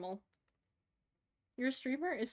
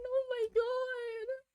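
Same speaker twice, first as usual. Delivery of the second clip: much higher, slow, drawn-out squeal; 12 semitones higher, much slower than usual. Real, not acted. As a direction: coo at something tiny. Sees tiny gun.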